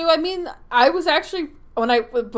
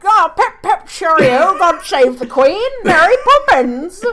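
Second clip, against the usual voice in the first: hammed-up posh British, higher and louder; mock British accent; 6 semitones higher, 9 dB louder than usual. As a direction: parody a bad game's fake British. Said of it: "They were all like".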